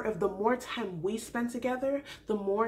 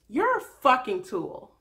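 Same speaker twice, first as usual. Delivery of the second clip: slow, louder, spat-out contempt; angry insult; 6 dB louder, slower than usual. Real, not acted. Vlogger insulting the Bachelor, slow angry contempt. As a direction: lean hard on every word.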